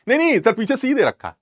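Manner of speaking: high-pitched imitation of a student; correcting student. Student corrects the bluffing teacher again. (टोकते छात्र की ऊँची आवाज़ में नकल)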